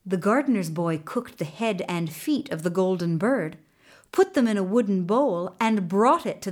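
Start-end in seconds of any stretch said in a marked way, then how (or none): none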